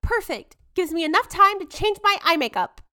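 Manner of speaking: high, bright spoiled heiress; London voice. Acted. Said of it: "You will be London".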